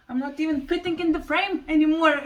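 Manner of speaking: laughing as the words come out. Real, not acted. Laughing at the plant outgrowing the frame. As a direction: giggle through the line at the giant plant.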